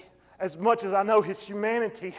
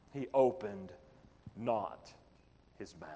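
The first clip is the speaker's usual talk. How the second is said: hushed; 10 semitones lower, 11 dB quieter than usual. Acted. Preacher hushing the congregation, softly. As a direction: let the words fall away quietly.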